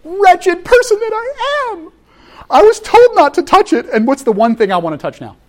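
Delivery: a high anguished lament, slowed right down; anguished Paul. Retold or imitated. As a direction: wail it like a tormented apostle. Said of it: "He's like".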